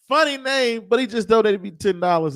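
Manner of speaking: high and loud, delighted; bubbling over with amusement; excited. Real, not acted. Amused by a donation.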